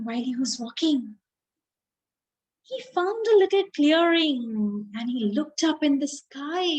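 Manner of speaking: plain reading voice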